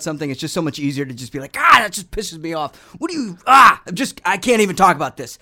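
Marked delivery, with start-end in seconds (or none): 1.6-5.4 loud, high Boston rant then calming; mad Boston accent. Retold or imitated. Bostonian ranting, then cooling off in his accent. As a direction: get pissed like a Boston guy, then talk yourself down. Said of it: "getting mad is so easy in a Boston accent".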